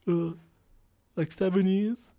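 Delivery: high squeaky, questioning answer; high-pitched hesitant captive; put-on captive voice. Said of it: "this is the voice".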